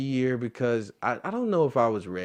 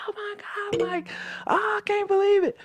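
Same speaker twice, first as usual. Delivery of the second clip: much higher, fast, squealing mom voice; excited mom. Retold or imitated. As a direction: gush like a starstruck mother. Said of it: "So she's tripping".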